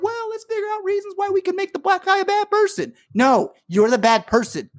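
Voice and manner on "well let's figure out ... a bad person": mocking quote. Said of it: "every one of these fucking people are like".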